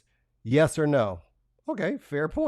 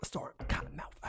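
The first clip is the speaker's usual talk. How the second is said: whisper; 13 dB quieter than usual. Acted. Reading a tweet aside.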